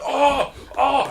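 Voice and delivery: high pained "oh"s; high mock yelps; imitated bird cries. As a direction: mimic a knocked-over bird crying out. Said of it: "it was going like".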